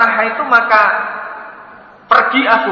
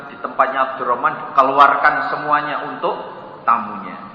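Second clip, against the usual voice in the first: usual speaking voice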